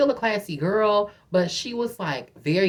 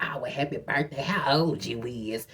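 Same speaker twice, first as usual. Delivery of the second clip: low, soft imitation of a man; flirting stranger; 4 semitones lower, 6 dB quieter than usual. Man wishing her happy birthday, low soft imitation. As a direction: drop lower and softer, slowing at the end. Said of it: "He's like".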